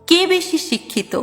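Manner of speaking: ordinary delivery, nothing marked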